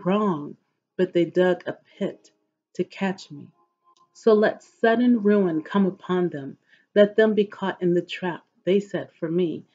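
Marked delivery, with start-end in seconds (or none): none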